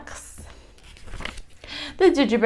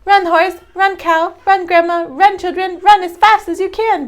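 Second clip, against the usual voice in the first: a much higher, louder gleeful taunt; gingerbread man shouting; 11 semitones higher, 7 dB louder than usual. A narrator's reading. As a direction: shout back at everyone chasing. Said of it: "laughs and shouts".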